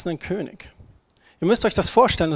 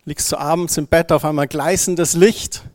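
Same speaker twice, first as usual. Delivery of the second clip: ordinary delivery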